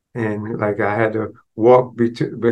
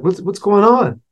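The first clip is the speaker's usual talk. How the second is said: higher, hurried worried mother; urgent mom checking on her boy; frantic; 8 semitones higher than usual. Retold or imitated. Mother asks her crying son, frantic and higher.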